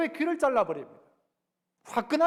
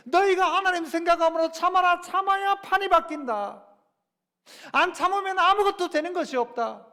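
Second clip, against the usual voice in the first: Peter's command, higher and louder; Peter's exhortation; 6 semitones higher, 3 dB louder than usual. Retold or imitated. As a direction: proclaim Peter's charge with force. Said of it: "베드로가 이야기하는 것이죠".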